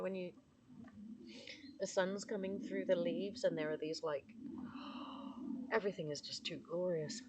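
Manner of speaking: ordinary talk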